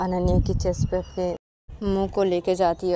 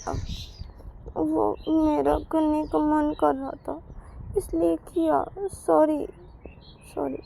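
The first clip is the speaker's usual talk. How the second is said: sheepish excuse; 9 semitones higher, slower than usual. Acted. Payal explains sheepishly.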